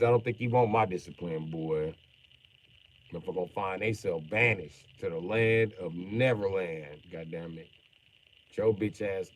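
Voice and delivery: normal talking voice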